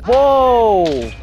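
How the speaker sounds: high drawn-out loud whoa; long startled whoa. Real, not acted. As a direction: let the shock ring out. Close call in firefight.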